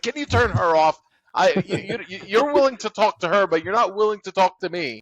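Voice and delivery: loud, high-pitched mock indignation; imitating pissed-off wife. Husband retelling his jealous wife's complaint, mock annoyed. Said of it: "she gets really pissed off".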